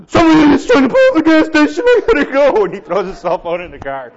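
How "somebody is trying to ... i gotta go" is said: high, loud, rushed panicked shout; mock panic. Imitating a man fleeing the gas station, panicked yelling. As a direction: yell it frantically, in comic alarm. Yuppie panics into his cell phone.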